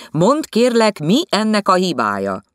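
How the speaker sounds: high, loud offended retort; offended snap. Acted. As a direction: snap back, stung. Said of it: "Csattant föl sértődötten".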